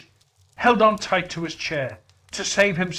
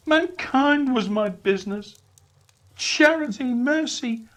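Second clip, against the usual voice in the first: high, louder mournful spirit; Marley's ghost lamenting; 9 semitones higher, 6 dB louder than usual. Acted. Marley's ghost lamenting his chains to Scrooge, high and mournful. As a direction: wail out regret.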